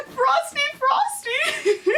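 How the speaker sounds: very high squeal breaking into laughter; mock screaming. Author repeating the brother's Frosty scream, laughing mock scream. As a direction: squeal it excitedly, cracking up. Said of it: "you went off screaming".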